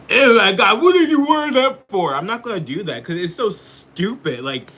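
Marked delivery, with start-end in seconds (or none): 0.1-2.1 much higher and louder, squealing mock disgust. Mimicking someone at a bar seeing a furry costume, shrill disgust. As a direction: act out a grossed-out bar patron. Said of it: "I'll be like".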